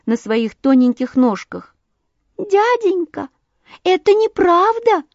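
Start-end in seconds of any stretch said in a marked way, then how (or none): 2.4-5.0 high, piping little protest; small bird squeaking up at a grown-up; thin little bird voice. A narrator's reading. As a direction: object as a tiny sandpiper. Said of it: "тоненький голосок".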